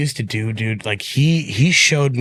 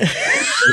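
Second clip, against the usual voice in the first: high laugh. Real, not acted. Laughing at the memory.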